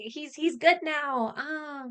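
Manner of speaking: slow, high dreamy sigh; mock swooning princess. Mocks Rey as a swooning princess.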